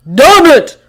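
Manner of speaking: furious roar. A narrator's reading. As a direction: roar it out in rage. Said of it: "Thor roared".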